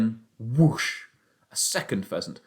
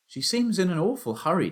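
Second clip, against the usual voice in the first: put-on boy's voice; 7 semitones higher than usual. Boy asks father, worried.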